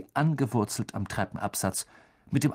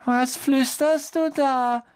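high, slow, louder ghostly whine; moping ghost girl; 5 dB louder, slower than usual. A narrator's reading. Ghost catches boys whispering, high slow suspicious whine. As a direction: challenge them in a high suspicious wail.

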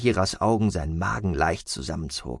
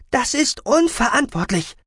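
high, urgent protest; raised, emphatic female character voice; outraged call; 4 dB louder than usual. A narrator's reading. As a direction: cry out in indignation as the medic. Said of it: "rief Salbassa".

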